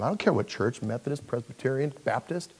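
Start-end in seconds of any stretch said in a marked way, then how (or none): none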